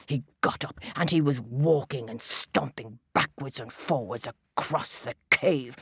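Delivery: ordinary delivery, nothing marked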